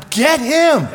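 high, drawn-out excited call; eager command. Retold or imitated. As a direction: call it out high and slow with relish. Jesus eagerly picking misfit recruit.

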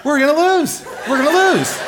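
high-pitched exasperated wail; comic despair. Retold or imitated. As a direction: cry it out high, in mock despair. Exasperated verdict on hopeless team.

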